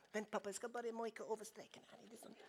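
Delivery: put-on quiet, dragging child's stalling; a child busy drawing, brushing Papa off; lightly voiced little girl; daughter voice.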